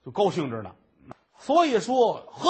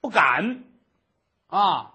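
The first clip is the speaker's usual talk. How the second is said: plain reading voice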